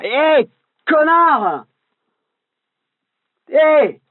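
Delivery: high furious yell at a cyclist; angry shout. Acted. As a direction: yell it high and loud at him. Un vélo lui fonce dessus. (crie de colère, très aigu)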